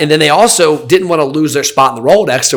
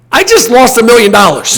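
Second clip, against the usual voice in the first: higher, louder burst of disbelief; exasperated outburst; 10 semitones higher, 5 dB louder than usual. Retold or imitated. Telling angry boat customers about his own loss, exasperated and louder. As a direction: blurt it out, fed up. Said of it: "And I'm like".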